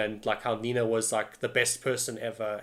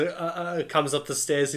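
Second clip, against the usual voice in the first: usual speaking voice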